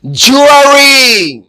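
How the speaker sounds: جوری stretched very slowly and loud; teacher modelling جوری; slow careful pronunciation. Shown as a demonstration. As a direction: draw out جوری for learners. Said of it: "اس کا تلفظ".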